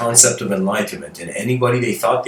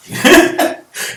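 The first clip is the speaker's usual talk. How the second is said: burst of laughter; 5 dB louder than usual. Real, not acted. Laughing at own impression.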